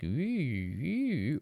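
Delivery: soft "ooh" swooping up and down in pitch; high, quiet gliding whoop, twice over; imitated floating swoops. Player describing bobbing through the air, swooping noise. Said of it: "you kinda went like".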